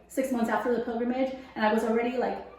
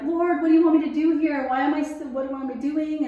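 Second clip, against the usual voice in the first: higher and louder, earnest questions to God; pleading prayer; 5 semitones higher, 6 dB louder than usual. Retold or imitated. Saleswoman crying out to the Lord about her career, pleading and louder. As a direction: call up to the Lord, asking for answers.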